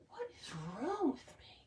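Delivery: breathy, choked-up near-whisper; teary whisper. Real, not acted. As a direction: let the tears catch the words. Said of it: "Why am I getting emotional?".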